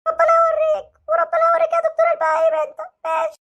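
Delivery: put-on hurt boy threatening the doctor with his dad; sulky hurt child. Same child cursing doctor.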